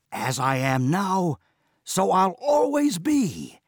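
loud, slow and high boast; slow crowing proclamation; proud declaration. Acted. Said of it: "Mabon declared".

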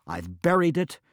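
high, loud, exasperated blurt. Acted. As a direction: throw the question back at him. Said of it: "blurted Mabon".

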